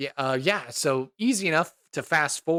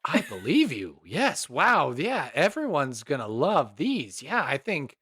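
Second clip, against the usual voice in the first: ordinary talk, nothing marked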